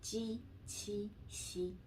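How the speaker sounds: ji, qi, xi with flat tongue, voiceless, unaspirated; crisp Mandarin ji, qi, xi; Chinese ji qi xi. Shown as a demonstration. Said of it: "how to pronounce".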